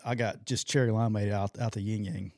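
usual speaking voice

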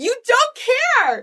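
high-pitched mock exasperation; exasperated outburst. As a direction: lose it at an unbothered child, retold. Retold outburst, lost all control.

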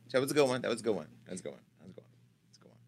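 fading echo into a whisper; fading away. Shown as a demonstration. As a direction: let it die away to nothing. Repeats phrase, fading away.

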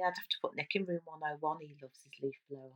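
whispered aside. Real, not acted. Whispered aside about husband.

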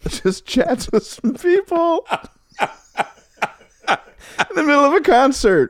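telling it through high laughter; laughing. Real, not acted. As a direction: laugh while you describe it.

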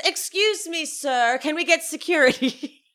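high-pitched imperious request; mock diva summoning the staff; Meredith. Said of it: "and she says".

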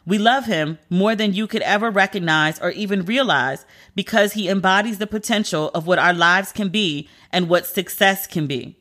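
ordinary talk, nothing marked

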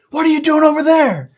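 high, loud yell across a raging river; ranger yelling. Retold or imitated. Ranger yells across the raging river, loud and high. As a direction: shout it over the water. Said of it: "he's having to yell".

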